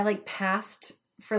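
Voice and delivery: ordinary talk, nothing marked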